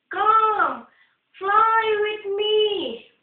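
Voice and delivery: high, slow inviting heron; lightly voiced heron, coaxing. A narrator's reading. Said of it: "said the heron".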